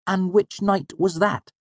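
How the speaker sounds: high, slow pointed question; pressing. A narrator's reading. Sim questions sleepless Manet, pressing. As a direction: press for the answer, slowly. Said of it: "Sim pressed".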